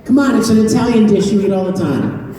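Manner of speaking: usual speaking voice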